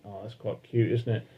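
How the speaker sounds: normal talking voice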